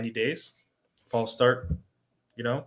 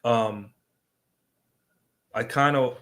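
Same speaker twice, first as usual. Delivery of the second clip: ordinary talk, nothing marked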